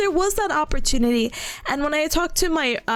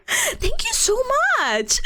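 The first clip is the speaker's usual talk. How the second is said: laughing; 11 semitones higher, slower than usual. Real, not acted. Repeating the line laughing.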